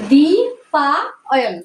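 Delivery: দ্বিপায়ন stretched out very slowly, syllable by syllable; slow drawn out. Shown as a demonstration. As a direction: draw out দ্বিপায়ন slowly so the child hears each part. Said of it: "দেখো". (দ্বিপায়ন খুব ধীরে টেনে টেনে বলা)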